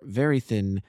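ordinary delivery

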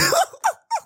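sharp high squeal of laughter. Real, not acted. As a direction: burst out laughing.

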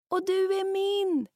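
high, slow call from far away; faint distant call. Acted. Lost Rut answers from afar, faint call. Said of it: "hörs ett svagt rop".

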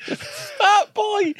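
high, laughing exclamation; excited. Real, not acted. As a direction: call it out like a film title. Excited pun on film title.

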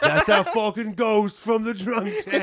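loud, high, slow through laughter; laughing recognition. Retold or imitated. Bar stranger spotting the drunk tank ghost: laughing shout. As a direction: blurt it out, barely holding in laughter.

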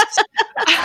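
squeaky burst of laughter; high laugh. Real, not acted. Laughs at own quote.

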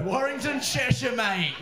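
loud, high, delighted repeat of the hometown; delighted crowd echo. Retold or imitated. Comic echoes the lad's hometown to the crowd, delighted. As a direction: crow it back to the room.

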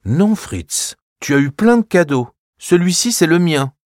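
put-on guard your toy like a child; firm little girl. Clara refuses to show Fritz her toy, firm.

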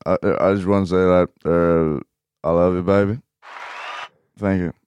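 lightly voiced rough-edged film star; Bradley Cooper. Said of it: "Ska jag prata som han i filmen?".

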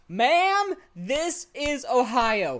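high, loud, drawn-out mock scolding; mock indignation. Cohost scolding an imagined customer about Ohio, mock indignant. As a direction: hammer it slowly in mock outrage.

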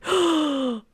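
high startled gasp; sharp gasp. Retold or imitated. She runs inside to the leaking sink, gasping in shock. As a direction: gasp in alarm at the flood.

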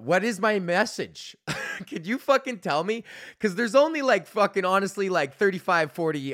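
half-laughing, pleading; excited. Real, not acted. Host excitedly asking listeners to tell him his message.

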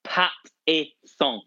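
slow mock-French pronunciation; put-on French, nasal final vowel; French accent. Shown as a demonstration. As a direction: sound out each syllable in French. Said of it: "it's French".

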